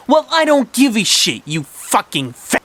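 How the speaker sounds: high, loud bratty cursing; acted angry retort; defiant. A narrator's reading. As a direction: sneer back high, slowing on the insult. Martha curses back at Alice.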